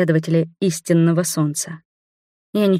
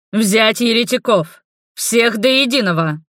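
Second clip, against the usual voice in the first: commanding shout; 4 semitones higher than usual. A narrator's reading. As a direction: order the troops to seize. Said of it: "приказала своим воинам". (приказ воинам в бою)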